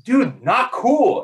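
high, drawn-out mock reproach; imitated scolding. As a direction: stretch it out high, imitating appalled teammates. Imitates teammates scolding him.

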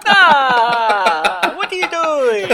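high, loud, drawn-out wail; mock-shriek like a horrified Italian; hammed-up cry of protest; outraged Italian scream. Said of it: "the sound an Italian makes when you poke".

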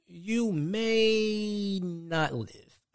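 dad's ominous warning, each word dragged out playfully; high, long-held words of mock menace; drawn-out mock warning. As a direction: stretch it out high and very slow. Acting dad's warning before a whooping.

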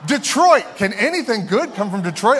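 high, loud snobby scoff at Detroit; mock scoffing. Preacher mocks a scoffer doubting Detroit, loud and high. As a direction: sneer the question out loud. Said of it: "it would be like modern day if we're like".

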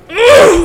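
high, loud groan of delight; ecstatic groan. Real, not acted. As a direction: let out a big foodie groan. Ecstatic about the favorite food.

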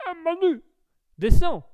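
high, loud sketch holler; Les Inconnus impression. Streamer demonstrates the comedians' manner, hollered high. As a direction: yell up to a pal, comically. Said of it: "façon inconnue".

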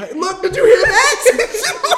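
loud, high, slow, laughing mimicry; mimicking co-host. Pointing out the co-host's accent, loud laughing mockery. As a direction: crow it mockingly through laughter. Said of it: "It's like".

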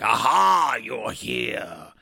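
grand theatrical actor voice, loud Aha; theatrical stage villain greeting; Peter O'Toole voice. Acted. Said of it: "Sorry, that's my Peter O'Toole voice".

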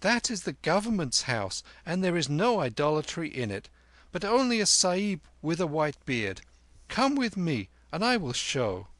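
put-on high, brisk boy; high-pitched bossy young guide; confident boy Kim. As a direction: explain in a high, assured boy's voice. Narrator plays Kim.

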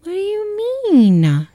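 high, slow, drawn-out innocent question; mock innocent voice. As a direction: play dumb, stretch it out slowly. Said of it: "she's like".

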